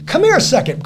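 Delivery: high, loud mock beckoning call; excited holler to come over; calling people over. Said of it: "calling people".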